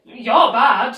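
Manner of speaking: a loud, pointed yell back; shouted retort. Retold or imitated. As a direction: throw it right back in her face. Yelling back at host mother.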